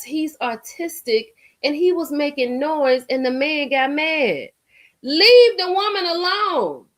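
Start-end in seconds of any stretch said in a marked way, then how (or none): 5.0-6.9 indignant. Real, not acted. Angry outburst at abusive man.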